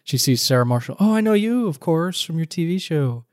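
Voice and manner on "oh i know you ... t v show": higher, sugary fake-friendly voice; sweet on top, barbed underneath; passive-aggressive compliment. Lightly voiced sugary dig. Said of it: "these passive-aggressive digs".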